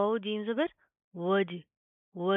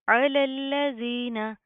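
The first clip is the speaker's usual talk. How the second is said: slow, louder recitation of अलल्लज़ीना; tajweed recitation; 3 semitones higher, 6 dB louder, much slower than usual. Shown as a demonstration. Teacher reciting slowly for students. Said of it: "आइन की आवाज़ को आपने proper वाज़े करना है".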